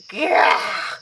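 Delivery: short loud unvoiced Gah; sharp shout. Catching herself sounding like a queen, sharp loud Gah. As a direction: blurt out a startled Gah. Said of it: "I sound like a queen".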